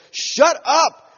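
loud, high acted outburst; hammed-up crowd rebuke; angry shushing. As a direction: shout down a nuisance. Mimics crowd silencing the beggar.

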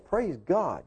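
soft, subdued praise; accepting praise. Acted. Preacher acts praising God after a bandsaw accident, subdued. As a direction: quietly praise despite the loss. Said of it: "Praise God no matter what happens".

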